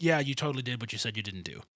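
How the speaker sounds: normal talking voice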